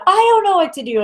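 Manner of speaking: high-pitched mock helpless complaint; frustrated. Client complaining to the guides, frustrated. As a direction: wail it lightly as a lost client. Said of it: "they're as frustrated as you are".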